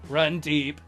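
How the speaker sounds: normal talking voice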